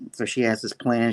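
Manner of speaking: ordinary delivery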